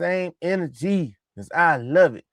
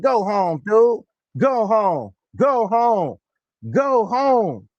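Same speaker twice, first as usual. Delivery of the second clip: higher, drawn-out dismissive taunt; mock taunt; 5 semitones higher, much slower than usual. Voicing Allen dismissing his opponent, higher mocking taunt. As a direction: send him off, voice raised in pitch.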